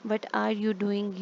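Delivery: slow example reading. Shown as a demonstration. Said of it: "reading the example sentence aloud".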